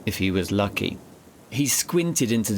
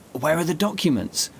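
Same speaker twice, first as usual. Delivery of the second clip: higher, urgent panicked question; a touch of panic, pushed up high; sudden panic in a narrated line; panicked hiss; 7 semitones higher than usual. A narrator's reading. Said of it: "George hissed in sudden panic".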